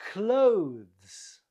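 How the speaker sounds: "Clothes" as one long, loud syllable; correct pronunciation. Shown as a demonstration. Teacher models the right way, "Clothes" as one syllable. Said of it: "Not clothes, but clothes".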